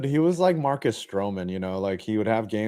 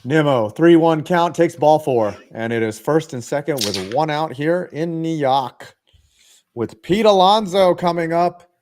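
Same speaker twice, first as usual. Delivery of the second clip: louder, raised baseball announcer call; play-by-play call; 5 semitones higher, 5 dB louder than usual. Acted. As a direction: call the walk like a live broadcaster.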